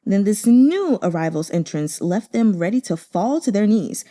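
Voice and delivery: plain reading voice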